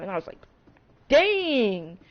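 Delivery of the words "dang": a high, louder Dang! in amazement; shocked at the number; surprised exclamation. Retold or imitated. Reacting to sales figure.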